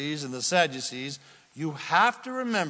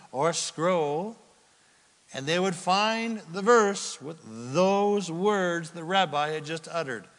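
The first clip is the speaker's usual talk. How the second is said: ordinary talk, nothing marked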